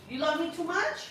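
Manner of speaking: soft, high mommy voice. Real, not acted. Mom answering her child's "I love you", soft and high. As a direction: coo it softly as a high, delighted question.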